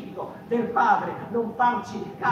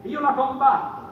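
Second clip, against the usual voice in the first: Giuseppina's defiance; 5 semitones higher, 7 dB louder than usual. Acted. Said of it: "dice lei".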